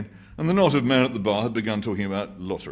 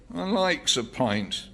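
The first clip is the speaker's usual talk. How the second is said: put-on slow, higher, insistent old man; stubborn old man; 8 semitones higher, much slower than usual. A narrator's reading. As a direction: dig in on wanting a pint. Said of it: "persisted the old man".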